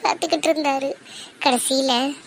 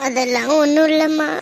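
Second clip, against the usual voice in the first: ordinary talk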